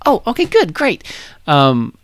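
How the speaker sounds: high, bright burst of cheer; bubbly excited. Acted. Renoa announcing guests to Squall, high and bubbly. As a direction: chirp it out delighted.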